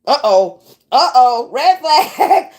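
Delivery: loud, laughing uh-oh alarm; alarmed warning. Retold or imitated. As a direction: sound the alarm half laughing. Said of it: "just embrace the fact".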